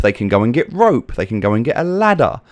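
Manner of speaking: ordinary talk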